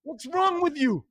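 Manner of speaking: slow, high, angry American accent. Shown as a demonstration. Podcast guest finishing his American accent demo, high and angry. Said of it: "slightly angry".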